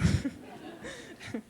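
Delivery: high breathy nervous giggle; nervous laugh. Real, not acted. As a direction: laugh off the nerves. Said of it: "So I'm kind of nervous".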